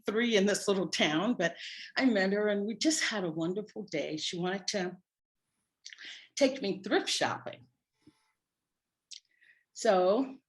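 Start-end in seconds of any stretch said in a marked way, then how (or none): none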